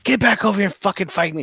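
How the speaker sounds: a high, hammed-up enraged yell; furious yelling. Wounded fighter yelling after the one who fled, raging. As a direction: scream threats at the fleeing enemy. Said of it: "She's yelling".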